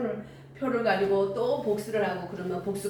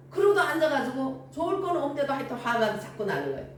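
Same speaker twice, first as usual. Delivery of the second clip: normal talking voice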